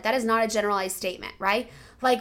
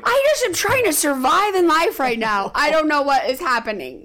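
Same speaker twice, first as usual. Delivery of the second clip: mock frazzled outburst; 5 semitones higher, 6 dB louder than usual. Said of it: "I'm like".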